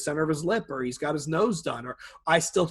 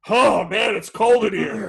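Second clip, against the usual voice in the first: mock complaint; 5 semitones higher, 6 dB louder, slower than usual. Mock complaint dropping pants.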